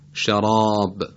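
شراب with a thick, emphatic r before the alef; heavy emphatic r. Shown as a demonstration. The teacher modelling a Quran word, heavy r. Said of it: "حرف را تفخیم بشه".

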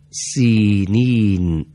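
سینین with its first syllable drawn out long and slow; sinin demo. Shown as a demonstration. Teacher demonstrating Quranic recitation to students, slow drawn-out سینین. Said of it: "به تلفظ حرکات اول این دو کلمه توجه بفرمایید".

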